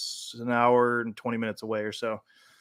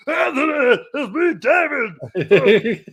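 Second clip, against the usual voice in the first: very high, loud overexcited squeal; high, slow, loud fanboy shout; freaking out; 14 semitones higher, 6 dB louder, slower than usual. Retold or imitated. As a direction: gush at him like a starstruck fan. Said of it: "he freaked out".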